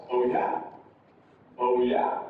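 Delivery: "Oh yeah?" starting low, rising on YEAH; rising intonation. Shown as a demonstration. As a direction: begin "Oh yeah?" low and lift it. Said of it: "It's going up".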